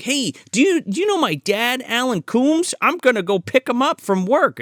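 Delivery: high-pitched eager kid impression; imitated kid. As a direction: mimic a boy piping up in a high voice. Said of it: "he's like".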